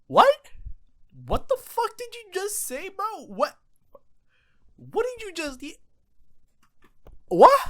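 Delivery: high, loud outraged what; real high-pitched gobsmacked yelps; stunned disbelief. Real, not acted. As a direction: blurt it out in total shock. Shocked reaction to the clip.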